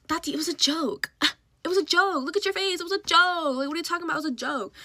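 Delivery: high, laughing imitation of teasing friends; mock teasing. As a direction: brush it off in a high, amused voice. Friends laughing off the prank, retold.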